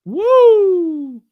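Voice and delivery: high, loud whoop of delight; excited whoop. Real, not acted. Cheering that Mom and Dad are finally moving, a loud high whoop. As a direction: cheer it like great news.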